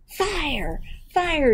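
high, hammed-up false alarm; cried alarm. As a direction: cry out high as if sounding an alarm. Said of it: "he cried".

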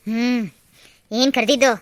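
ordinary delivery

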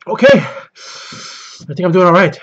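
slow, strained from the heat; forcing words through pain. Real, not acted. Pushing through spicy pain.

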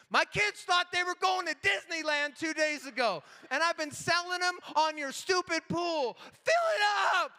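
hammed-up outrage at the desk clerk; ranting dad. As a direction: build to a desperate demand. Dad rants at clerk over pool.

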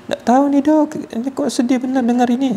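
mock tearful woman answering the doctor; imitating the tearful patient. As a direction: play the patient, lost and sad. Crying patient quoted answering doctor.

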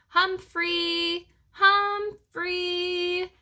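high, long drawn-out searching call. A narrator's reading. Searchers call for the lost hamster, calling out. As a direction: sing the name out across the yard. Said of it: "Voices called out".

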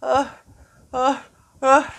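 stuck for words in front of the parents; frustrated standing out the front, stammering; tongue-tied frustrated. Pretend. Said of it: "stood out the front going".

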